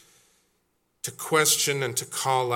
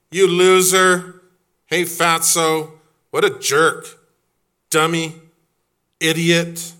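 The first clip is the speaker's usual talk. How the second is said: loud, higher schoolyard jeers; playground taunting; 4 semitones higher, 7 dB louder than usual. Acted. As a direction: hurl insults across the playground. Playground insults called out as examples.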